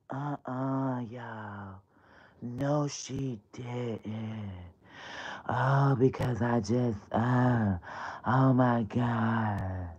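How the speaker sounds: soft, low, slow put-on murmur; mock Brittany voice. Comedian stays in Brittany's hushed voice, softly. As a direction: keep her hushed voice going. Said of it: "She talked like this".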